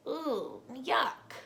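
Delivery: mock grossed-out ooh, yuck; disgusted. Child narrator reacting to creepy crawlies, mock disgusted.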